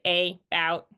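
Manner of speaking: about broken in two, "a ... bout"; split into syllables. Shown as a demonstration. Teacher showing where about breaks, "a", pause, "bout". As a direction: say "a, out" as two separate beats of about. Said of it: "Where are you breaking it?".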